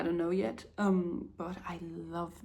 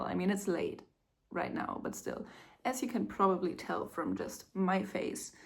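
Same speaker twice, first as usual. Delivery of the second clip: ordinary talk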